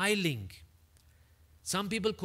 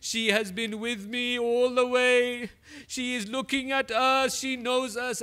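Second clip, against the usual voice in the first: higher and louder, mournful; grieving lament; 9 semitones higher, 7 dB louder than usual. Acted. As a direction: cry it out as a son's lament. Imam tells of his mother watching.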